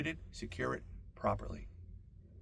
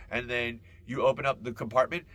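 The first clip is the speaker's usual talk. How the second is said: ordinary delivery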